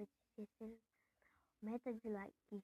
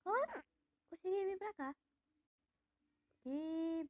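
excited; 7 semitones higher, 5 dB louder than usual. Real, not acted. Gets through a game level.

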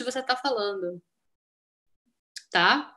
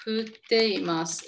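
slow demo; much slower than usual. Shown as a demonstration. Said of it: "Está chovendo aqui".

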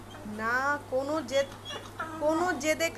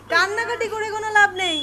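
firm scolding; 6 semitones higher, 8 dB louder than usual. Real, not acted. Mother scolding crying toddler.

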